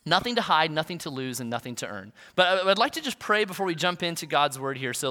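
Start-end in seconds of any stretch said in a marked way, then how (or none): none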